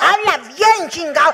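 loud, laughing, half-hammed scolding and pleading; laughing mock yell. Mother and child shout during the owl scare. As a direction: yell it while cracking up.

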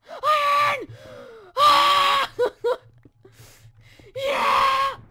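high, loud scream of joy; ecstatic shout. Real, not acted. Gamer screaming in joy at finally finding iron. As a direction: scream with triumph.

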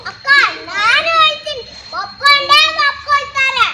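insistent. Acted. Girl repeating promise insistently.

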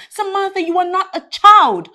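firm scolding. Acted. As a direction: lay it down hard. Friend lectures Sam firmly.

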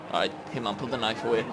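low, soft aside to the character; quiet instruction. Pretend. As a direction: tell him softly, under control. Telling character, first take.

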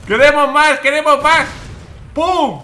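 high, loud, greedy excitement; full-voice, high-pitched cheering; exultant shout. Real, not acted. Exulting in zombie kills.

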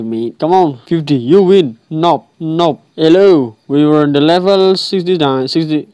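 normal talking voice

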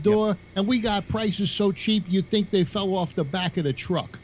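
high-pitched mock local pitchman; appliance store owner. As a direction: imitate a New York store owner's own commercial. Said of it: "used to do his own commercials".

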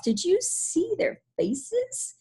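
high, drawn-out amused glee; laughing. Acted. Billy mocking his fleeing friends, laughing glee. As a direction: gasp it out slowly in high-pitched mirth.

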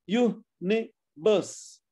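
UNIVERSE slowly in three beats: u, ni, verse; syllable by syllable. Shown as a demonstration. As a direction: pause between each syllable of universe. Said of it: "Universe has three syllables".

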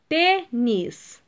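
"Tênis" split in two, "Tê" stronger; penultimate stress. Shown as a demonstration. Teacher giving pupils an example, stressing "Tê" in "Tênis". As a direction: separate "Tênis" and hit the first syllable "Tê". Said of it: "é a penúltima sílaba tônica".

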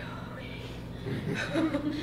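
hushed, half-laughing whisper; reluctant whisper. Acted. Answering the Holy Spirit's prompt, in a reluctant whisper. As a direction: whisper it to yourself, daunted. Dreading the prompt to ask more.

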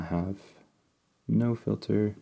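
ordinary talk

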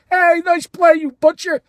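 loud, high mock call from the stands; heckling. Fan jeering a player's error, loud mock heckle.

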